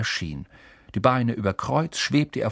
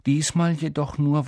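ordinary delivery, nothing marked

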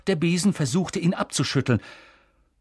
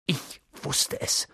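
whispered, slow and breathless; breathless gasp; 6 dB quieter, slower than usual. Acted. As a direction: gasp it out under your breath. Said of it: "keuchte Hermine".

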